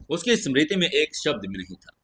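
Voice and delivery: usual speaking voice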